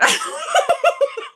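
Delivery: high breathy burst of laughter; laughing at own joke; real laugh. Real, not acted.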